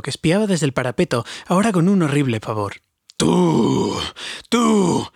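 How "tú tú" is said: higher, rising furious cry; a dwarf's outraged double yell; furious shout. A narrator's reading. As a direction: hurl it at the hobbit in rage. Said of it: "gritó Thorin".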